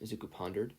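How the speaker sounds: ordinary delivery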